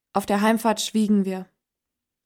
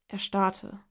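plain reading voice

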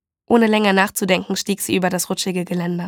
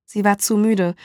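ordinary delivery

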